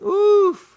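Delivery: loud, high whoosh of relief; exaggerated "uf" of relief; relieved phew. Believer trusts the pastor's promised cheque, exaggerated relieved sigh.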